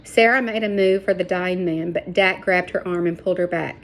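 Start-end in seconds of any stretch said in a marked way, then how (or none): none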